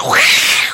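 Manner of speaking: loud mock demonic roar; hammed-up burst of demonic noise; demon screech. Imitates demons bursting out at home.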